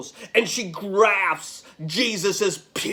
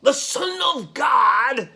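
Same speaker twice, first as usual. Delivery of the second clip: higher-pitched taunt with a scornful laugh; mocking Annas; 3 semitones higher, slower than usual. Annas challenging Jesus to be rescued, jeering. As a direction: goad him, voice lifted, then drop for the last words. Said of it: "Annas adds".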